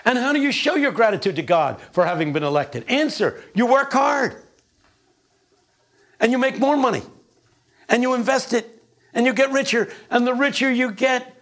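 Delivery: high, rousing pulpit cadence; preaching. Acted. Preacher driving home the prosperity gospel, rousing. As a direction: preach it up to the rafters.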